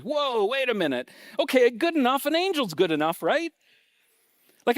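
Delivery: ordinary talk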